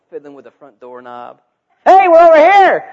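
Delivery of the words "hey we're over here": high, loud imitated kid's yell; calling out. Hiding children calling to the seeker, shouting. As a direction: yell it like a child giving away the hiding place.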